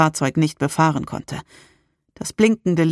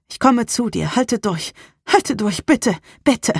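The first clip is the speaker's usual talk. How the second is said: higher, urgent begging; pleading; 6 semitones higher than usual. A narrator's reading. Rain promises Rebecca to come, pleading. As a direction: beg her to hold on. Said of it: "mein Flehen".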